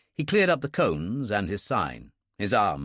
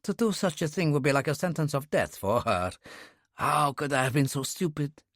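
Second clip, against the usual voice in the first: hoarse, softer apologetic voice; 2 semitones higher, 3 dB quieter than usual. A narrator's reading. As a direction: say it hoarsely, shaken and ashamed. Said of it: "He said hoarsely".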